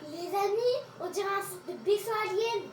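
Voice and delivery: awed excited. Real, not acted. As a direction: marvel at an alien site. Awed at spooky structure.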